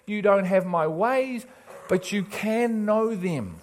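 high, loud, urgent preaching; full-force preaching. Real, not acted. Preacher pleading with people killing themselves, impassioned full-force preaching. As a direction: plead with them from the pulpit, pitched high.

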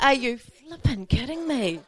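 high, laughing mock outrage at the news; exasperated disbelief. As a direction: act out the disbelief, laughing through it. Retelling her outburst at husband.

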